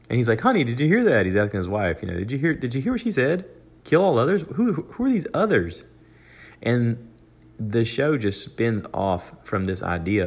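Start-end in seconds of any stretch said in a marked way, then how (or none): 0.4-1.3 alarmed. Retold or imitated. Husband alarmed asks wife. 2.2-5.8 high, rushed, disbelieving questions; alarmed disbelief. Retold or imitated. Husband alarmed asks wife.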